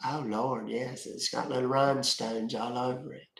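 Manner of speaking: lightly voiced old lady, soft and quiet; a hint of a sweet old granny, hushed; Beverly old lady. Voicing Beverly to the chat about the bedazzled computer, lightly voiced old lady. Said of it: "Beverly needs to tell them".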